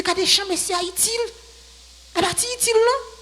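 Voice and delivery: high-pitched imitation of a doubter; mock questioning. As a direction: voice the objection high, rising to the end. Acts out someone's questioning objection. (imitasyon moun k ap doute, ak vwa byen wo)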